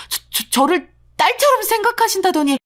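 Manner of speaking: shocked stammer. Acted. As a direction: stumble over the words, hurt and stunned. Stunned daughter-in-law stammers. (충격받아 더듬는 목소리)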